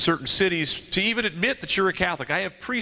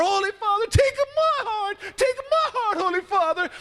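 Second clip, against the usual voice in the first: yelling louder. Acted. Crowd yelling louder as the feather drifts down, very high. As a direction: beg louder as the feather falls. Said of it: "the people yell even louder".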